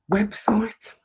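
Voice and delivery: a sudden unvoiced whisper on one word. Real, not acted. Host whispers the website name to viewers, hushed. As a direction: drop into a hushed aside.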